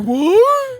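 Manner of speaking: high, loud, startled squawk; mock shocked disbelief. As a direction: react as if your bluff was called. Cocky Shinji caught off guard.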